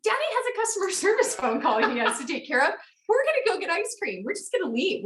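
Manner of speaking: high, fast, sing-song fake cheer for the children; chirpy parent to kids. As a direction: sweeten it up to hustle the kids out. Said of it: "I'm like".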